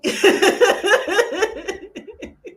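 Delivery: high loud laugh. Real, not acted. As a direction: burst out laughing. Laughing at donation song.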